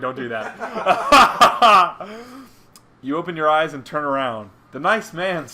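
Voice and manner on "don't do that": slow, loud laughing plea. Real, not acted. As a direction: beg through laughter. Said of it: "Oh, God, [name]".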